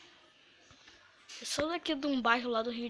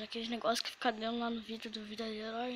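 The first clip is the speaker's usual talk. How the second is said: usual speaking voice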